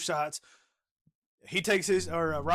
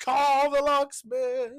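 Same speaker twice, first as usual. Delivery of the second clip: loud, high, drawn-out holler; calling out; 9 semitones higher, 8 dB louder, much slower than usual. Acted. Co-host quoting the chastity belt gag, slow loud call. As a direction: holler it slowly like a summons.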